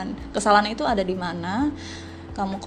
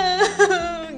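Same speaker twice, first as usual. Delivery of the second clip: high giggle; laugh; 8 semitones higher than usual. Real, not acted. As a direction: giggle at your own act. Laughing at own reenactment.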